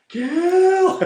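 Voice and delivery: very high, loud mock shout; hammed-up grandma screaming for blood; bloodthirsty grandma yell. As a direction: scream it from the stands. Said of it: "This lady went from trembling to".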